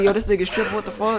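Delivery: high, startled reaction, imitated; the teller imitating how startled he was in the car; retold alarm. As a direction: replay your own shock. His own alarmed reaction, retold.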